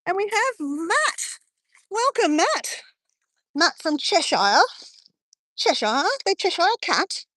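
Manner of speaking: high-pitched, drawn-out mock English accent. Streamer welcoming Matt from Cheshire in a mock English accent. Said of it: "That was my English accent".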